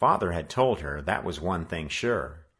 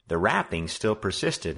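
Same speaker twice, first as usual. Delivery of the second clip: plain reading voice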